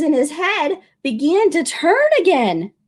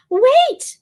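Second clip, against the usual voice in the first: high urgent wait; calling wait; 11 semitones higher, 3 dB louder than usual. Acted. Boy stopping the shopkeeper, calling out high. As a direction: stop them with a quick high call. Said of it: "said the boy".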